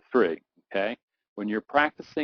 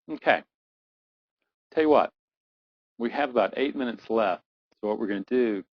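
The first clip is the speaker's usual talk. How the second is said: ordinary talk, nothing marked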